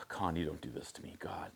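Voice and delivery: whispered groan of despair; despairing sigh. Real, not acted. Viewer pleading with the movie character, whispered despair. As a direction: breathe it out, worn down. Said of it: "sighs, despairing aside".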